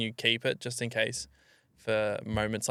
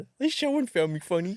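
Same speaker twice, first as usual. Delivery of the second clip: high, exaggerated pitiful whine; mock sad; 8 semitones higher than usual. Joker mimicking his own sad remark, hammed-up. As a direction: parody a wounded sad sack. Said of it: "That sounded so sad".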